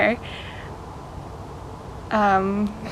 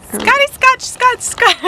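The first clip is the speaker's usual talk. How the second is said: silly high voice; 14 semitones higher, 4 dB louder than usual. Real, not acted. Warming up, silly.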